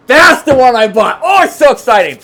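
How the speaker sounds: high, loud burst of delight; real excitement. Real, not acted. Man interrupting his own story about the Jeep he bought, very excited. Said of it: "I'm so excited because".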